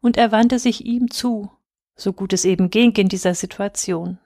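usual speaking voice

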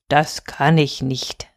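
low, slow, measured voice; gentle moon refusal. A narrator's reading. Good moon turns down the boy, slow refusal.

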